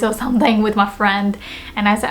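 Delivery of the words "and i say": normal talking voice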